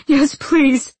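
high, loud tearful begging; sobbed plea. A narrator's reading. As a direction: beg desperately, near tears. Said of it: "he sobbed".

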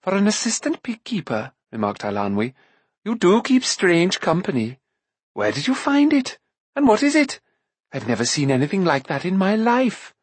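high, teasing and amazed; a girl marvelling at an odd creature; mocking girl. A narrator's reading. Eilonwy's voice in the story.

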